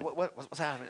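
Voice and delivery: hushed, confused mutter; a hint of alarm; mock startled newcomer. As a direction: play the shocked visitor who can't follow. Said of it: "And you're like".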